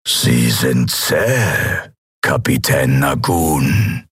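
low, very slow, drawn-out creature; deep, unhurried alien menace; bizarre voice. A narrator's reading. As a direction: drag each word out deep and eerie. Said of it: "sagte die bizarre Stimme".

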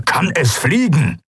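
slow, insistent question, pitch rising; snorting impatience. A narrator's reading. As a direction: press impatiently for an answer. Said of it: "schnaubte er". (unwirsch schnaubend, langsam nachhakend)

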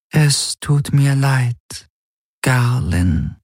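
slow, strange disembodied voice; otherworldly Shadow Helix. A narrator's reading. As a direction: apologise slowly, as a presence in his head. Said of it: "hörte er die Schattenhelix sagen".

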